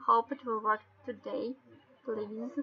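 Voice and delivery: ordinary talk, nothing marked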